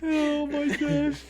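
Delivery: laughing. Real, not acted. Slow high disbelief.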